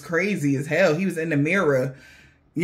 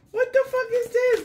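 shocked outburst. Real, not acted. Shocked by the reading.

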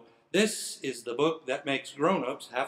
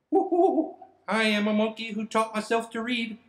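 high, loud, excited monkey; 7 semitones higher, 5 dB louder than usual. Pretend. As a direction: whoop like a proud little monkey. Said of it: "and my monkey voice".

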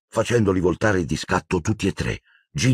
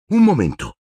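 high, louder sudden stop; sudden interjection; 8 semitones higher, 4 dB louder than usual. A narrator's reading. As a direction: cut in abruptly. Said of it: "disse all'improvviso Hermione".

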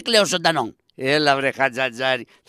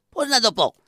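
usual speaking voice

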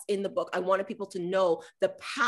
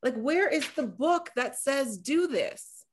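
scolding her younger self in disbelief; exasperated at herself; 6 semitones higher than usual. Retold or imitated. Recalling her late discovery, incredulous and high-pitched. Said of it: "and then I was like".

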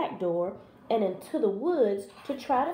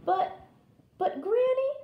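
put-on high-pitched stammering girl; uneasy little girl; hesitant Little Red; 13 semitones higher than usual. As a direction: falter in a high child's voice. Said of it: "said Little Red".

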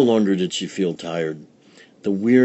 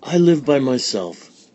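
put-on higher, louder old woman; old village woman; 6 semitones higher, 5 dB louder than usual. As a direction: explain humbly. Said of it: "said Befana".

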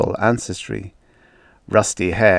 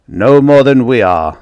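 sharp, much louder retort; snapped; 4 semitones higher, 9 dB louder than usual. A narrator's reading. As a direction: snap it back irritably. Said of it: "snapped Zakheim".

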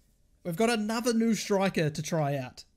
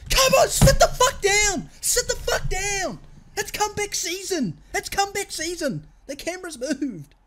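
real ecstatic high-pitched cheering; triumphant celebration; 9 semitones higher, 4 dB louder than usual. Real, not acted. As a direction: crow over a goal at the rivals. Celebrating a comeback goal.

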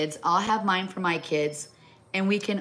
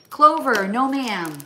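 sharp loud call to the dog, then a firm no; 6 semitones higher, 8 dB louder, slower than usual. Real, not acted. Owner scolding her dog Clover by name, loud call. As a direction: scold the dog from across the room.

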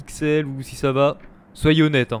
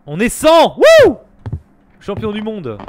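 big excited shout, much higher and louder; cheering; 7 semitones higher, 12 dB louder, slower than usual. Real, not acted. Streamer celebrating a hundred viewers, cheering loudly. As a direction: whoop in celebration, full throat.

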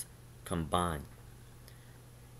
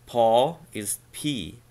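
ordinary delivery

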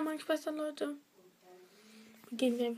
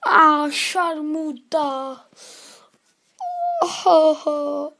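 pained groan; 9 dB louder, much slower than usual. Real, not acted. In pain after falling.

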